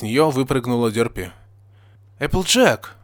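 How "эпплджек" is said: high-pitched astonished call; surprised. A narrator's reading. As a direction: call the name in amazement. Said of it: "удивлённо говорил Эпплблум".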